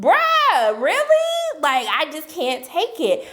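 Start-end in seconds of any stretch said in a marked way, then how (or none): 0.0-1.6 loud, high-pitched incredulous reaction; exasperated disbelief. Acted. Viewer who can't stand Fred, exasperated disbelief. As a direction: let the disbelief leap right up high.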